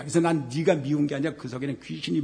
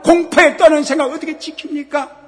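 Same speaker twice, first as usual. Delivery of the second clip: raised pulpit voice; fervent preaching; 9 semitones higher, 13 dB louder than usual. Acted. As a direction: press the promise hard on the listeners. Preacher building on the verse.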